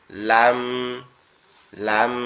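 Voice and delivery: লাম said softly, tongue tip to the palate; লাম repeated with a soft clear l; soft lam demo. Shown as a demonstration. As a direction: model লাম with a soft, light l. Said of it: "এভাবে".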